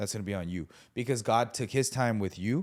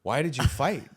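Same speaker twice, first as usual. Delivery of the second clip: shocked pastor; 5 semitones higher, 5 dB louder than usual. Retold or imitated. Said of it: "a pastor's face".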